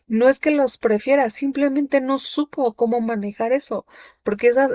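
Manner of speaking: usual speaking voice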